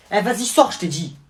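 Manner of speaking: crie, plus aigu et plus fort; chasse-le de la chambre; ordre crié. Acted. (crie au cousin de sortir)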